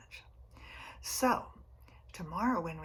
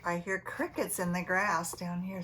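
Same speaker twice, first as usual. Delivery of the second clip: usual speaking voice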